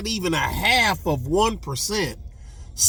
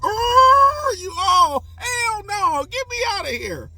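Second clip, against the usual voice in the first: siren-like wail. Retold or imitated. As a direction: howl it like a siren. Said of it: "hear that siren go".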